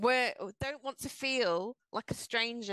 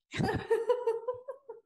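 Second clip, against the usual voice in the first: high bursting laugh; burst of laughter; 14 semitones higher, 3 dB louder than usual. Real, not acted. Laughing at a stranger's smug answer, high laugh. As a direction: laugh out loud at the absurd reply.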